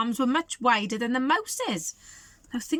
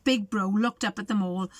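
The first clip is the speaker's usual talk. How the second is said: usual speaking voice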